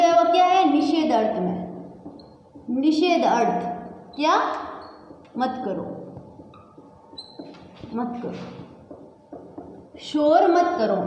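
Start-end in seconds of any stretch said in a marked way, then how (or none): 5.4-8.3 soft repeated shush to the class; quiet "मत करो"; hushed. Shown as a demonstration. (धीमे स्वर में रोकना)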